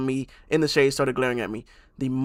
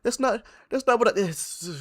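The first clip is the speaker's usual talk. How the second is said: mock flustered mother; 7 semitones higher than usual. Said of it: "This is like".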